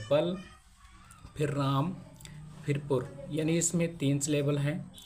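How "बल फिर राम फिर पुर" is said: बल, राम, पुर each said apart with pauses; syllable by syllable. Shown as a demonstration. Teacher counting three syllables, each one set apart. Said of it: "Balrampur में तीन syllable है".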